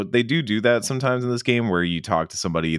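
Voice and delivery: usual speaking voice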